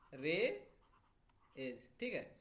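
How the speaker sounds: रे and एज pulled apart by a pause; रेज split into two syllables. Shown as a demonstration. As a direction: break रेज into रे ... एज. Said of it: "इस शब्द को देखो".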